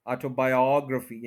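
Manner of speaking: Autobiography as aw-to-bai-AWG-ruh-fee; fourth-syllable stress. Shown as a demonstration. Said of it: "fourth के ऊपर stress है".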